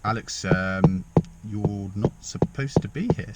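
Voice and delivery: a hint of a slow, stern teacher; lightly voiced teacher. Teacher telling him he shouldn't be in, slowly and mildly stern. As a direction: drawl it out like a mild telling-off. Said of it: "the assistant head teacher was like".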